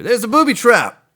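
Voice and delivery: loud, high ad-announcer voice; commercial announcer. Retold or imitated. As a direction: belt it like a radio ad. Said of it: "I just played that commercial".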